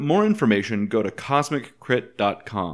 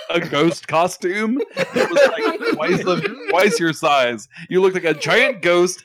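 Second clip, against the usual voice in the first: giggling through the words; laughing; 6 semitones higher, 5 dB louder than usual. Real, not acted. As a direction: laugh while describing it. Laughing recounting ghost costume.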